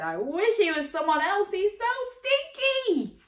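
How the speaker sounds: giant voice. Acted. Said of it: "but he said".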